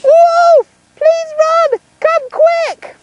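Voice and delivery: high, loud calling shout; hollered cry for help. Acted. Boy hollering to town folk, shrill high alarm call. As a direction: yell for the town to come running. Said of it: "He hollered".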